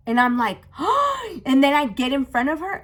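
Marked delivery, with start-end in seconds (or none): 0.8-1.4 high, sudden gasp; shocked gasp. Acted. Teller reliving the moment she looked, shocked gasp. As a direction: gasp as if just catching sight. Acts out her shock, retelling.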